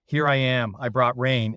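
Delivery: usual speaking voice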